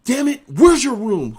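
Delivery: loud, high, slow bark; angry officer. Retold or imitated. Officer chewing out Murphy about his room, loud high slow bark. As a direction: chew out a sailor. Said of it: "He said".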